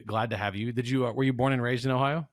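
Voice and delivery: ordinary talk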